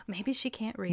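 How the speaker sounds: hushed, mock-shocked realization; mock gasp. Joking to a friend, hushed mock-shocked aside. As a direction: gasp then drop to a sly hush.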